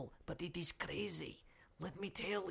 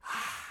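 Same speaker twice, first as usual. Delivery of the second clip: long, airy sigh of relief; comic exhale of blessed relief. Acted. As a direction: let out a long relieved "ahh". Relieving himself into a bottle.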